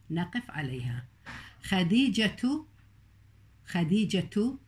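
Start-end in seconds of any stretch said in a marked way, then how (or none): 1.6-4.6 خديجة held slowly on a rising end; pausal ending demo. Shown as a demonstration. Teacher calling out the word خديجة to show its ending. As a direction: draw out the last sound of خديجة. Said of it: "لاحظ التاء ذا ألفظها".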